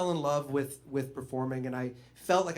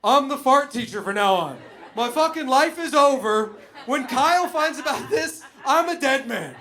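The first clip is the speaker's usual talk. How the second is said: loud, wide-eyed despair, laughing; imagine his life ruined; doomed teacher inner voice; 10 semitones higher, 9 dB louder than usual. Retold or imitated. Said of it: "just really like".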